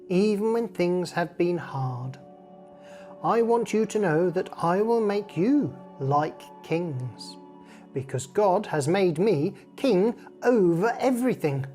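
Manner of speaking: ordinary delivery, nothing marked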